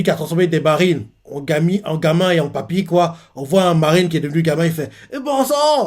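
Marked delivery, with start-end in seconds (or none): 5.1-5.9 put-on high squeaky child's exclamation; kid voice. Marine turned child curses in a boy's voice. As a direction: pitch up into a little boy's voice. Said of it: "avec sa voix de gamin".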